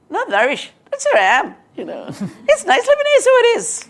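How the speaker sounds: imitating a Northern Irish lad, high and loud; a quick, loud, chirpy Northern Irish impression with a laugh; playful Northern Irish accent mimicking a young interviewee. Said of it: "but you talk like that".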